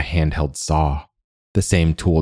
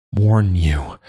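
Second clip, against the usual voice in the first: choked out; 2 semitones higher than usual. A narrator's reading. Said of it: "he choked out now".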